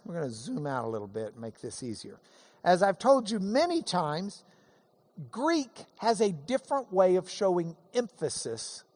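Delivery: ordinary talk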